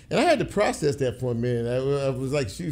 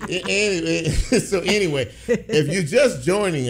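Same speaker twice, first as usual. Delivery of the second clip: laughing; 6 semitones higher, 5 dB louder than usual. Real, not acted. Laughing while resuming the show.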